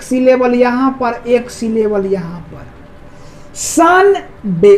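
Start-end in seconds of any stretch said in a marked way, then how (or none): 3.6-4.8 Sunday slowly, सन and डे held apart; two separated syllables in Sunday, सन then डे. Shown as a demonstration. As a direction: model Sunday for students as सन … डे. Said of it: "उच्चारण करिए".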